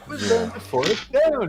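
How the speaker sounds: slow, soft attempt at English accent; half-successful slow English-actor accent; Michael Caine impression. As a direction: draw it out in mock English. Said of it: "I can't do that same accent".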